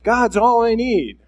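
over-the-top pious swoon; mock gushing piety. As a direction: ham up a showy churchgoer. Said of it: "And be like".

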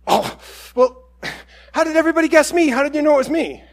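put-on high, loud, stunned girl; startled disbelief. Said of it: "And she's like".

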